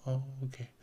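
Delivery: soft, faint, indifferent "oh, okay"; a hint of a faint, tired old man; frail old man reply. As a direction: lightly imitate a frail elderly man. Frail old Stan Lee reply.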